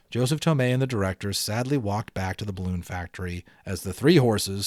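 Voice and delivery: usual speaking voice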